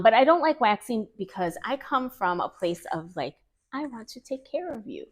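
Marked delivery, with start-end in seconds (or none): 3.7-5.0 softer, higher, tender reassurance; caring. Retold or imitated. Beautician explaining her caring approach to clients, tender.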